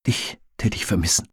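soft, quiet confession; said softly. A narrator's reading. Friend admits she would miss her, softly. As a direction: murmur it softly to your friend. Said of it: "sagte sie leise".

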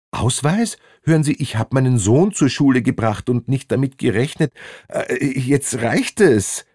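high, hurried protest; indignant man at the end of his patience; exasperated passenger. Acted. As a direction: protest fast, then drag out the last words. Stopped man protests to inspectors.

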